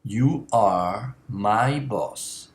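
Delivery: slow, deliberate example sentence. Shown as a demonstration. Teacher giving a formal example slowly. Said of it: "to your boss".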